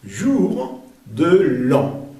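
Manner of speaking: "Jour de l'an" very slow, word by word; spaced-out model of "Jour de l'an"; nasal an. Shown as a demonstration. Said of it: "Otra vez el sonido nasal an".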